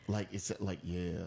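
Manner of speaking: very deep, drawn-down crooner voice; playfully dropped-low demo. Shown as a demonstration.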